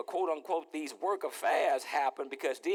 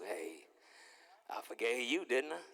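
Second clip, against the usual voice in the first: gentle reminder; 6 semitones lower, 8 dB quieter than usual. Acted. God gently reminding him at night.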